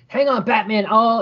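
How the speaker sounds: put-on high-pitched urgent young hero; Blue Beetle. Comic reader voicing a hero telling Batman to hold on, high and urgent. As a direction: call out high, lightly acted urgency.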